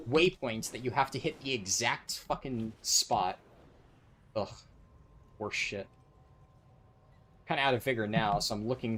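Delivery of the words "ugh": ordinary talk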